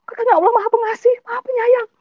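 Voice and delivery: "Maha Penyayang" wailed very high, voice straining; mock God's mercy in a high sobbing cry; tearful angry rant. She throws God's own names back at him in a high tearful wail. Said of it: "Pokoknya saya ngoceh, saya marah".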